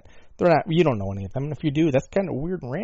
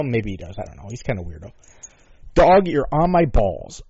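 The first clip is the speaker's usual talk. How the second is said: ordinary delivery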